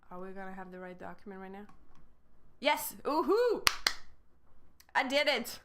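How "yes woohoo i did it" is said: loud, high woohoo of relief; cheering after the tech finally works; triumphant cheer. Real, not acted. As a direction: celebrate finally getting it working. Finally fixes the document live.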